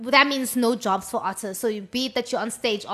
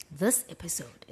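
voiceover voice; 7 semitones lower, 9 dB quieter than usual. Shown as a demonstration. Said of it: "like a voiceover, when it starts, it's like".

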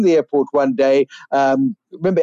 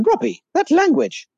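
much higher, prissy tut-tut reproach; mock prim scolding; 14 semitones higher than usual. Speaker scolding Robbie for swearing, mock prim reproach. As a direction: scold him like a shocked aunt, half joking.

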